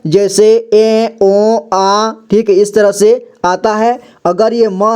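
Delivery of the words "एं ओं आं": ए, ओ, आ as slow nasalised vowels; slow demonstration of nasal ए, ओ, आ; nasal vowel sounds. Shown as a demonstration. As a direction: hold each of ए, ओ, आ through the nose, separately. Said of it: "विभिन्न प्रकार का sound आ सकता है".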